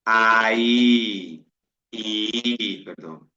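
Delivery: ordinary talk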